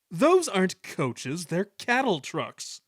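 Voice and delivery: slow, high, loud scorn; haughty indignant engine; snorting. A narrator's reading. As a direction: pour contempt on each word. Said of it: "he snorted".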